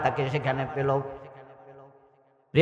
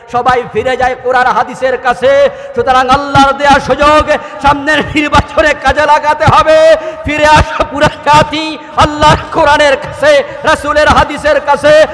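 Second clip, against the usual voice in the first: high, very loud rallying shout; preaching at full force; 10 semitones higher, 12 dB louder than usual. Real, not acted. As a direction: rouse the crowd with a sustained high-pitched cry. (উচ্চকণ্ঠে জ্বালাময়ী was)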